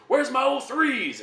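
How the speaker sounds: barked drill instructor call, high and loud; loud gung-ho soldier. Retold or imitated. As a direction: shout it like a drill sergeant. Drill instructor calling out recruits.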